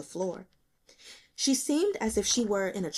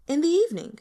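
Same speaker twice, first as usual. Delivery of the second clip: voiced girl; 9 semitones higher, 6 dB louder, faster than usual. Girl answers the detective.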